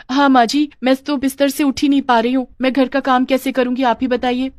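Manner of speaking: high, whiny pleading; pleading malingerer. Pretend. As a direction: plead helplessness you are only pretending. Shirker pleading false bed rest. (लाचारी का नाटक, ऊँची आवाज़)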